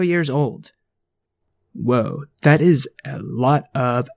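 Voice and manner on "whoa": ordinary talk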